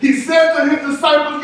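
ordinary talk

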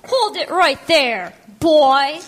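loud, slow scolding echo; mock-stern order. Sibling backs up Grandma against brother, loud mock-stern order. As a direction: order him to stop, drawing it out loudly.

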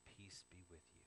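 hushed, low and gentle; calm them very softly; soothing blessing. Acted. Jesus speaking to those who are scared, soothing. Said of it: "He speaks to those who are scared".

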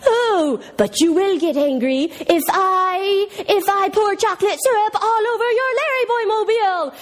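high, drawn-out cartoon villain taunt; evil mastermind. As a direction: goad the hero like a cartoon villain. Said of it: "the evil mastermind is determined".

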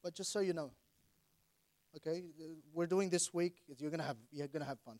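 holding back tears while speaking; blubbering. Real, not acted. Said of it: "Sorry, I'm crying".

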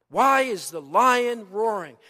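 normal talking voice

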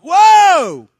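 very high, very loud whoa; church shout. Shown as a demonstration. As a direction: let out a joyful shout. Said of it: "pull off a shout".

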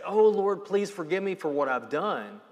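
pleading sinner. Retold or imitated. Imagined plea Cain never made.

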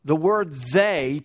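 slow, weighty "the word they"; stress on they. Shown as a demonstration. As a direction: lean hard on "they" and take it slowly. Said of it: "highlight or underline that word they".

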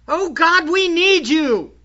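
impassioned plea. Acted. Preacher cries out to God before the church, impassioned plea. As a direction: plead with God, high and strong.

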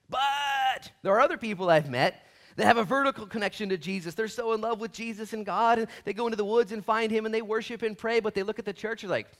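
high, loud, impassioned pulpit delivery; sustained high-pitched sermon shout; preaching at full force. Real, not acted. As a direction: preach it out at the top of your voice.

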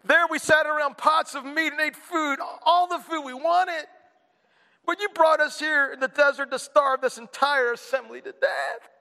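mock whining grumblers; whining Israelites. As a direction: moan like a spoiled crowd. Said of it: "The Israelites said to them".

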